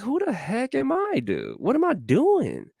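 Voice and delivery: very high-pitched, incredulous questions; bewildered self-questioning. Retold or imitated. Speaker recalling being in the same boat, bewildered high-pitched questioning. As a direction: pitch leaps way up in mock bewilderment. Acts out own past bewilderment.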